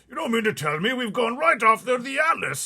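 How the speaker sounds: high, loud frightened cry; a blustering general in a panic; terrified army chief. Acted. Army head crying out from the back seat, terrified. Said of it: "he cried".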